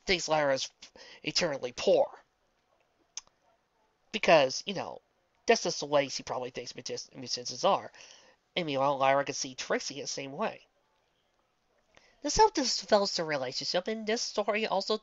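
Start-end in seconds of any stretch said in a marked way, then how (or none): none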